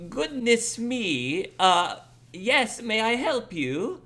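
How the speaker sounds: put-on high-pitched, startled old lady at the door; slow, high voice of a surprised elderly woman. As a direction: play a polite old woman, pitched well up. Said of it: "an old woman will open it".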